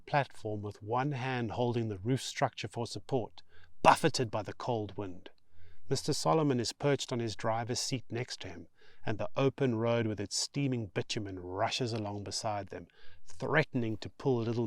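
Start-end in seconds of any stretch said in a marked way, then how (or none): none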